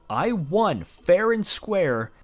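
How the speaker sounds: slow emphatic. Real, not acted. Recounting a stolen victory.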